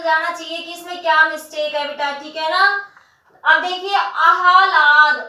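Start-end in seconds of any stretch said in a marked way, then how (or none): 4.0-5.2 आह्लाद drawn out slowly, the h sounded before the l; careful pronunciation. Shown as a demonstration. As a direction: spell out आह्लाद sound by sound for the class. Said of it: "है का उच्चारण पहले".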